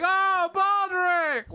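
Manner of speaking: very high, slow, loud cheer; adoring rat crowd. Acted. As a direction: squeal the name like a swooning crowd.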